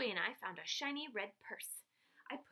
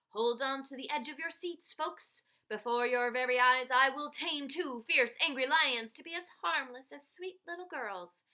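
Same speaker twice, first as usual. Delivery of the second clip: grand announcement; 3 semitones higher, 8 dB louder than usual. A narrator's reading. Dad announcing the finale to the audience, ringmaster loud. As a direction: bellow it to the crowd like a circus ringmaster. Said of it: "Dad announced".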